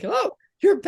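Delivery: high, bright mock surprise at a returning volunteer; mock surprised. Staff greeting the volunteer who came back, mock surprised. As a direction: squeal it like staff amazed she came back.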